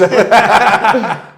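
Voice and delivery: loud high laugh; burst of laughter. Real, not acted. After six-seconds joke, loud laugh. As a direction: crack up at own joke.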